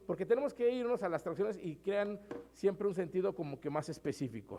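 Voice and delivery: ordinary talk, nothing marked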